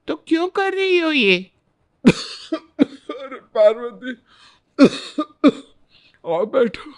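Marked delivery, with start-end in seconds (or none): 2.1-5.5 slow, feeble voice between forced coughs; faking illness. Acted. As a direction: play the sick mother-in-law for the guest. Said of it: "बीमार होने का नाटक". (बीमारी का नाटक करती कमज़ोर आवाज़)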